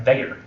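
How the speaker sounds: they're drawn into THEY-er; twangy they're. Shown as a demonstration. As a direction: say they're like two words run together. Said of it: "adding a kind of a twang".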